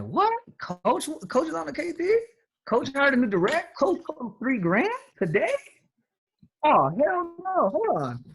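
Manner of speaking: high, disbelieving squeals; mock outrage, voice jumping up; hammed-up shock of a teammate who hears the coach is out there; shocked team member. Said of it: "because they see".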